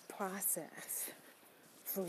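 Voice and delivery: breathy whispered word. Real, not acted. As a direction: drop to a whisper. Softening on a beautiful process.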